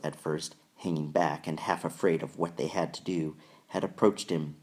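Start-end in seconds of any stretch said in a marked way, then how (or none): none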